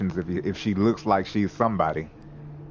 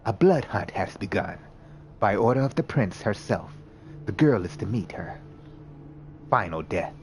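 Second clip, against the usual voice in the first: a hint of a hunter laying down orders; stern vampire enforcer. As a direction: Valerie confronts D'Angelo, lightly voiced menace. Valerie demands the girl.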